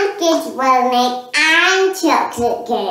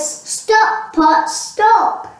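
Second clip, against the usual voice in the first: loud, high commanding shout; child shouts the magic words for Hannah, loud; 9 semitones higher, 7 dB louder, much slower than usual. Acted. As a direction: shout the spell at the pot. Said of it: "Hannah shouted the magic words".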